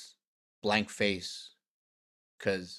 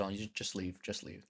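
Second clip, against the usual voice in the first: quiet seething dismissal; 8 dB quieter than usual. Retold or imitated. Furious but quiet dismissal.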